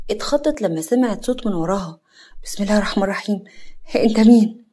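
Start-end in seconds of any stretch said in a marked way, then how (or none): 2.4-4.6 startled cry. Acted. Yara startled by the guard behind her. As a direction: jump at the sudden voice.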